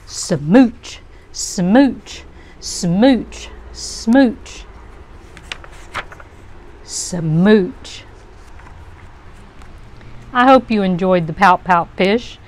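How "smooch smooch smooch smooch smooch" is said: playful smacking smooch sounds, each rising; kissy smooches. Acted. Kiss-kiss fish sending smooches to everyone, playful and rising. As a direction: blow a string of happy kisses. Fish now kisses, happy refrain.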